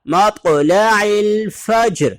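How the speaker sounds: drawn-out melodic tajweed; slow recitation with a bouncing ط; Quranic recitation. Shown as a demonstration. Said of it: "سورۃ قدر میں اللہ تعالیٰ نے فرمایا".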